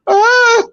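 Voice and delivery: mock pain yelp. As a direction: cry out like you took a punch. Imitating Paul Bearer's pained yelp.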